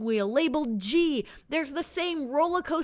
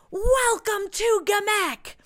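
crowd welcome; 8 semitones higher, 4 dB louder, slower than usual. A narrator's reading. Whole crowd greeting newcomer.

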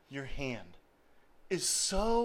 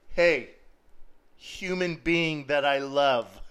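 laughing God voice; 3 semitones lower, 4 dB louder than usual. Acted.

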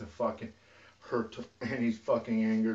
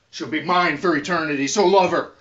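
much higher and louder warning; furious shout; 6 semitones higher, 10 dB louder than usual. Real, not acted. Rising furious plea to Danny.

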